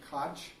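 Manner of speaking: Koch ending in a "ch" as in church; variant pronunciation. Shown as a demonstration. Teacher showing how some people say the name, variant pronunciation. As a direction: say Koch like "Kotch", short open vowel. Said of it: "Some people pronounce it Koch".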